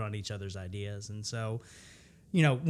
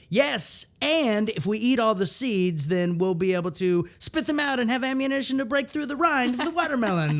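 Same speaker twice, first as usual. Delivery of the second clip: eager yes-and; 10 semitones higher, 6 dB louder than usual. Shown as a demonstration. Improviser building on the watermelon idea, high and eager. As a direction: pitch the plan with excited conviction. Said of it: "because you say".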